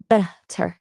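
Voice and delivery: "butter" slowly, with a soft uh in the first syllable. Shown as a demonstration. Teacher modelling the vowel for a learner, "butter" with a soft, slow uh. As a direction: say "butter" in two drawn-out parts, buh-ter. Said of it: "the O sound is softer".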